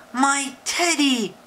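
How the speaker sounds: high, excited cry; cried out. A narrator's reading. Eddie crying out when he spots his teddy. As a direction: cry out for the lost toy. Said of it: "cried Eddie".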